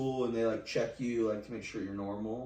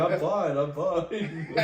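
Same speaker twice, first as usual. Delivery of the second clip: high, laughing "I'm fine"; laughing protest; 6 semitones higher, 5 dB louder, much slower than usual. Retold or imitated. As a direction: insist you're fine while cracking up. Retelling own protest, laughing.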